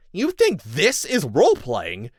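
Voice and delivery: sharp angry retort; snapped. A narrator's reading. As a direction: snap it back in anger. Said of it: "I snapped".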